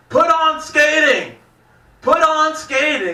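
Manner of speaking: put-on high, slow, slurry drunk yell; drunk guy yelling. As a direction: bellow it like a drunk at the bar. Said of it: "and he's like".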